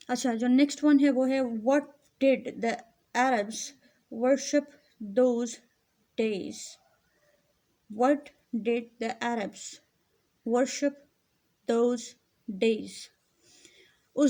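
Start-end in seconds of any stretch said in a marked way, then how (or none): 1.4-6.5 each word set apart with pauses; slow dictation. Shown as a demonstration. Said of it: "reads question slowly word by word". 8.0-12.9 slow, measured reading of the question. Shown as a demonstration. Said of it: "repeats question for class".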